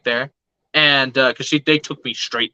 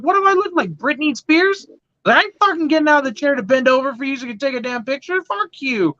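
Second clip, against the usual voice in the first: indignant retort; 9 semitones higher, faster than usual. Retold or imitated. Retold angry retort to doctor.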